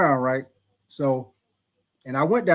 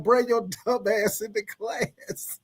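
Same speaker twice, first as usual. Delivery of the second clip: slow, quiet, drawn-out mock taunt; teammates taunting; 2 semitones higher, 5 dB quieter, slower than usual. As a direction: drag it out, teasing. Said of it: "they like".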